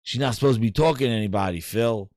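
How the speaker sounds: low, fast complaint to Phil; annoyed bodyguard. Retold or imitated. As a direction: rush it out low, fed up. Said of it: "He's like".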